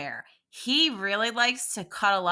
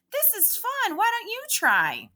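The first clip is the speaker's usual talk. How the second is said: bright encouragement; 12 semitones higher than usual. Shown as a demonstration. Teacher cheering kids to try.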